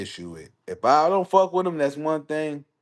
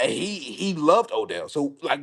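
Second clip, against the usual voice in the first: usual speaking voice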